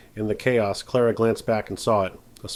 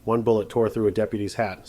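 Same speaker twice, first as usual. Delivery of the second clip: ordinary delivery, nothing marked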